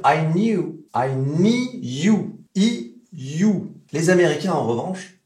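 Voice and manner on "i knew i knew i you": knew with a British glide like you; British knew, with the y sound in it; British accent. Shown as a demonstration. Teacher showing the British way to say knew. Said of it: "Les Britanniques vont dire".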